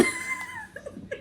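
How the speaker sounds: squealing laugh; high laugh. Real, not acted. Laughing at scene.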